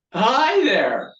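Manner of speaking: high, loud, eager hello; eager greeting. Acted. Twelve-year-old boy greeting the new girl, over-eager. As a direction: blurt out an over-keen hello.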